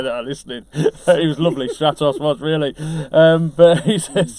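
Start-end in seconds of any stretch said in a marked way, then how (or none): none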